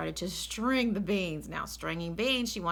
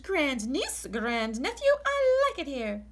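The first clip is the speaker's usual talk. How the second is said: much louder and higher, relishing; old aunt thrilled by the pie; delighted Great Aunt Myra; 7 semitones higher, 5 dB louder, slower than usual. Acted. Myra announces.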